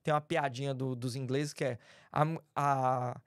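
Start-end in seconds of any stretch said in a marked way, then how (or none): 2.2-3.2 British accent with long, drawn-out open vowels; slow English gentleman's accent, stretching Charlie and bar; simulated British accent. Shown as a demonstration. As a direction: put on a very British accent, dropping the R. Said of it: "Dá pra você simular accent?".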